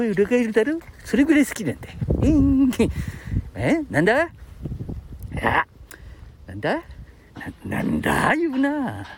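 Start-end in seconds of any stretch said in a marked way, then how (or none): none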